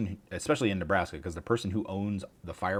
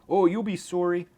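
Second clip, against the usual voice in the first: mock Canadian; 11 semitones higher, 7 dB louder, slower than usual. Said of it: "saying sorry".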